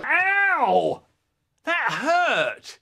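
high-pitched wail of hurt; pained yelp. Acted. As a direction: cry out high and stretched. Hurt child in playground fight.

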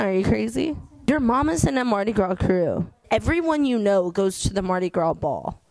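ordinary talk

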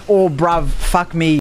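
loud mock British exclamation; British accent. Shown as a demonstration. Streamer shows the accent change while dashing, mock British. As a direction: switch into a put-on British accent. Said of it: "your accent changes".

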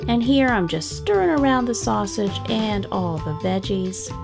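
usual speaking voice

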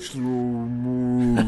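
slow-motion voice. Acted. Slow-motion voice mimicking game.

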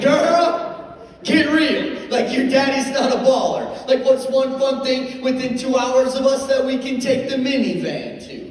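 high, loud mock scolding; dad squawking in comic disbelief; exasperated reality check. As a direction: set the kid straight, loud and high-pitched. Dad mock-scolds daughter's costly wish.

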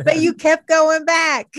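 high, loud, amused call-out; laughing tease. Real, not acted. Friend ribbing someone about a casual fling, laughing tease. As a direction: tease them loudly through laughter.

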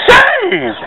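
soaring high, drawn-out preacher cry on saved; hammed-up televangelist wail; television preacher. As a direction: belt saved way up like a TV evangelist. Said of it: "Almost sound like a television preacher there".

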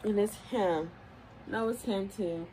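ordinary talk, nothing marked

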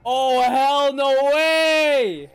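loud, slow drawn-out disbelief; stunned disbelief. Real, not acted. Anime reactor drawing out shocked disbelief. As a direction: stretch it out in shock.

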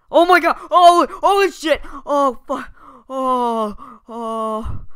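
high, loud fake terrified screams; scared horror game streamer. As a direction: overact the jump scare. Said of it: "have fake reactions".

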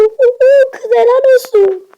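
high, loud excited whoop; excited cheer. Real, not acted. Kid cheering a game rival, high loud excited whoop. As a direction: cheer her on at the top of your voice.

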